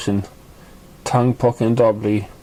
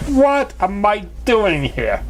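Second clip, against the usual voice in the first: high, slow, bewildered question; dragged out in dismay; Trixie, confused; 9 semitones higher, slower than usual. Acted. Trixie asks why she is in Ponyville, high, slow and bewildered.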